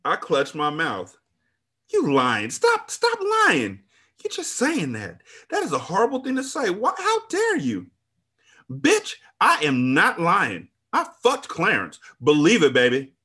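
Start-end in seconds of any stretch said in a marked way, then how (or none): 1.9-7.9 very high, anguished protest; horrified denial. A narrator's reading. Narrator refuses to believe friend's revelation, horrified. 8.7-13.1 high, loud, drawn-out taunt; defiant insistence. A narrator's reading. Friend insists on affair to narrator, defiantly taunting.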